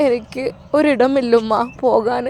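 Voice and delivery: halting, lightly acted crying; tearful. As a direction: confide helplessly, pausing after calling Mother. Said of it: "എന്തിങ്ങനെ കരഞ്ഞു വരുന്നത്". (കരച്ചിലോടെ, സങ്കടത്തിൽ)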